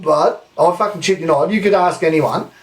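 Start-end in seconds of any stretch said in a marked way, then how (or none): none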